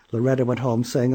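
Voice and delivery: plain reading voice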